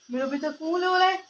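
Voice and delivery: mother cooing to her crying little daughter, high and sweet. Real, not acted.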